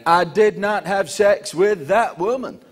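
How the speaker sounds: slow, deliberate Clinton denial; measured presidential denial; Bill Clinton impression. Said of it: "he went".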